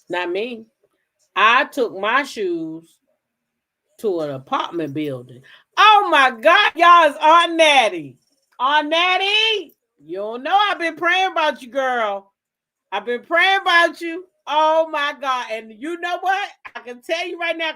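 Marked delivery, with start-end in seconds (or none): 5.8-11.7 excited recognition. Real, not acted. Spots aunt in audience.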